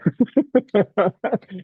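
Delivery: high, chuckling burst of laughter. Real, not acted. Teacher joking to students about reflective journals, real laughter. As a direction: laugh at your own aside.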